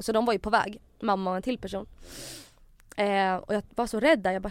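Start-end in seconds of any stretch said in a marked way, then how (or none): none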